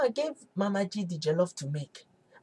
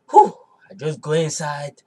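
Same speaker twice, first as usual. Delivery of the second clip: ordinary delivery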